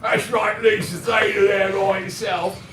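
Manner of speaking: loud, high, drawn-out mock Cockney; Cockney accent. Shown as a demonstration. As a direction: shout it in a hammed-up Cockney accent. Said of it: "instead of the Cockney accent".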